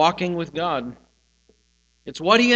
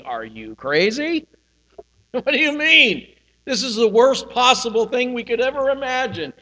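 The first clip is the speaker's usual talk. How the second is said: mock disbelief, pitched up with a laugh; incredulous disciples; 6 semitones higher than usual. Preacher imitates disciples protesting Jesus leaving, incredulous. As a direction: play the shocked disciples, half-laughing. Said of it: "disciples are saying".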